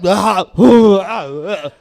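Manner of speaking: imitated heaving after chugging; retching noises. Shown as a demonstration. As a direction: act out nearly puking. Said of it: "I do this thing where I'm like".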